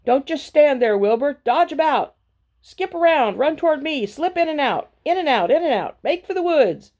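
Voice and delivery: high, loud, bossy goose barking orders; a goose shouting quick directions in a chase; commanding goose. A narrator's reading. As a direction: urge the pig on like a fussy honking goose. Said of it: "The goose took command and began to give orders".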